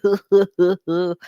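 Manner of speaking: a real short laugh; amused laugh. Real, not acted. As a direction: chuckle at yourself. Laughs at herself.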